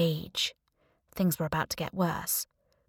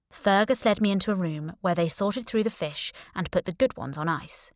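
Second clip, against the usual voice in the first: ordinary delivery, nothing marked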